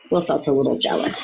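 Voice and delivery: plain reading voice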